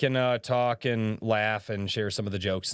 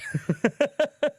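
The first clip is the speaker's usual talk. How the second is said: high laugh; 3 dB louder than usual. Real, not acted. Laughing after story.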